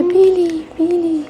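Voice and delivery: high mock call of a lost child's name; retold frantic call. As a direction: call out the name like a worried mother in a crowd. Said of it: "Sumisigaw siya".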